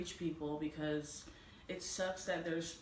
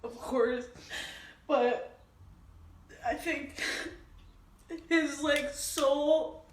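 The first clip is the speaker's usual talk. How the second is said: ugly crying; 6 semitones higher, 6 dB louder than usual. Real, not acted. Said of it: "sorry for the ugly crying face".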